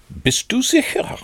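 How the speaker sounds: very high, incredulous question; greater astonishment. A narrator's reading. Rabbit doubts Pooh's identity, utterly astonished. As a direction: ask high up, in sheer amazement. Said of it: "fragte Kaninchen noch erstaunter".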